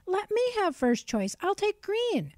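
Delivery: high, loud squawking parrot; screeching parrot. A narrator's reading. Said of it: "screeched the parrot".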